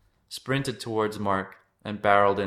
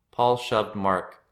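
ordinary delivery, nothing marked

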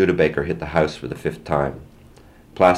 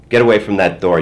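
angry order; 4 semitones higher, 6 dB louder than usual. A narrator's reading. Mom orders dad away.

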